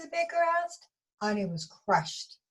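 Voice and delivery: plain reading voice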